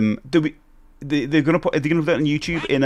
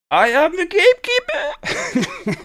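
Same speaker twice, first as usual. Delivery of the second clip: very high, drawn-out cartoon proclamation; gatekeeper; slower than usual. Streamer shows how the gatekeeper sounds, high and slow. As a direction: announce yourself in a squealing, hammy voice. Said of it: "He sounds like that".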